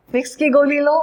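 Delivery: usual speaking voice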